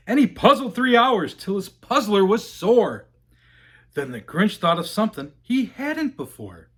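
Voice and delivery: usual speaking voice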